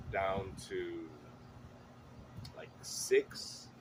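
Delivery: ordinary talk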